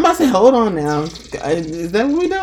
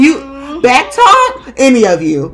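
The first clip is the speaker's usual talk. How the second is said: loud, drawn-out challenge; acted bossy telling-off; mock scolding; 5 semitones higher, 9 dB louder, slower than usual. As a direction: dare them to answer, loud and slow. Scolding her fears, acted.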